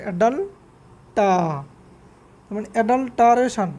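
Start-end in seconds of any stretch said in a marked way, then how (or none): 0.0-1.6 অ্যাডাল্টা drawn out slowly, each syllable clear; slow, careful অ্যাডাল্টা as the right way; correct pronunciation. Shown as a demonstration. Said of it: "এরকম উচ্চারণ হবে". (ধীরে স্পষ্ট করে অ্যাডাল্টা উচ্চারণ)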